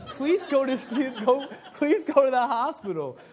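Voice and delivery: laughing while pleading please go to the hospital. Real, not acted. Pastor laughing at himself while quoting his advice to the sick.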